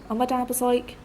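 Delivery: plain reading voice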